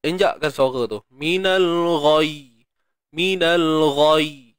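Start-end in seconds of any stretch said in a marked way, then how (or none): 1.1-2.6 Minal ghai with a thick throaty gh; slow, press the ghain in ghai; Arabic ghain. Shown as a demonstration. Teacher demonstrating ghai for the class. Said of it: "Tekankan sedikit". 3.1-4.5 Minal ghai with a thick throaty gh; slow, press the ghain in ghai; Arabic ghain. Shown as a demonstration. Teacher demonstrating ghai for the class. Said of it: "Tekankan sedikit".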